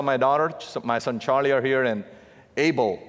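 ordinary delivery

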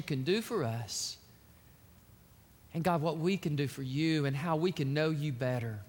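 soft, low earnest prayer; hushed pulpit prayer. Acted. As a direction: pray quietly and intimately to God. Pastor prays before the sermon.

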